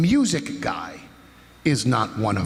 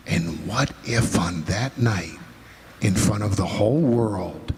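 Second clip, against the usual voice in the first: ordinary talk, nothing marked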